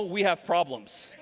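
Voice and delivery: usual speaking voice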